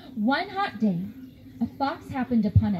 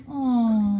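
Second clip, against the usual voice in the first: mock sad aw; 3 semitones lower, 4 dB louder than usual. Shown as a demonstration. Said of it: "Can you guys do that with me?".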